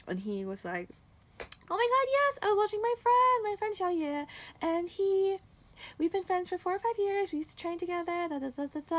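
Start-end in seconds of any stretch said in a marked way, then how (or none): 1.7-9.0 high, loud gushing retelling; excited friend. Retold or imitated. Lex hears she likes his friend, excited. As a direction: imitate a friend bursting with excitement.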